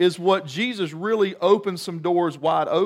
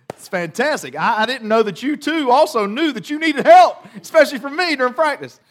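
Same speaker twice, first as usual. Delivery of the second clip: higher, louder, faster gushing sarcasm; mock enthusiasm; 8 semitones higher, 7 dB louder than usual. Telling the coach how fantastic it is, with mock enthusiasm. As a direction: gush with fake delight, dripping sarcasm.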